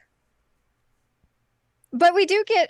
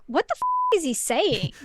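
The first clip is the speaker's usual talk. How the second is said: high-pitched jump of disbelief; baffled exasperation; 6 semitones higher than usual. Retold or imitated. Player who can't hear the actor, exasperated. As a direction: burst out baffled, then settle. Frustrated reaction to inaudible actor.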